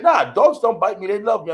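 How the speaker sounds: a touch of a show-off owner; mocking cocky dog owner. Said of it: "you got people like".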